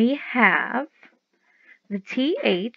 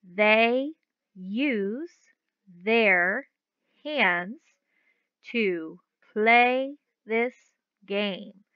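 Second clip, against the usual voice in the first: word by word, slowly; leading the class word by word; slow choral reading; much slower than usual. Shown as a demonstration. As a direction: read each word with a pause after it. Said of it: "I want you to read it with me".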